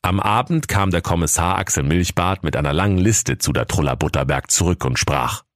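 ordinary delivery, nothing marked

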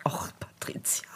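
soft exasperated whisper. Real, not acted. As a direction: sigh her name under your breath. Mock-exasperated whisper to cohost. (genervtes Flüstern)

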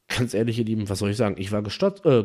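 ordinary delivery, nothing marked

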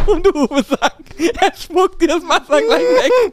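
speaking through real fits of laughter, pitch jumping high; can barely get the words out for laughing. Real, not acted. Retelling the captain moment.

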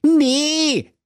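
high, silly prince voice calling out; a dim prince crying no; prince's shout. Acted. Vain prince refuses the dragon, high silly voice. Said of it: "rief der Prinz Schwerenöter".

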